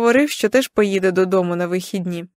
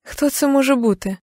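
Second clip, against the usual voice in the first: ordinary delivery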